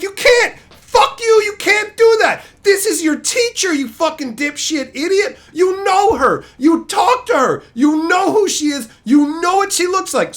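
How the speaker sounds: high, loud, indignant yelling; outraged rant. Acted. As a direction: yell at the beaver in disbelief. Acted outrage at the beaver.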